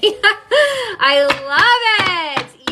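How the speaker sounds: thrilled, long high-pitched exclamation; delighted gush. Real, not acted. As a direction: gush it with a laugh, stretching LOVE way out. Delighted by the card's message.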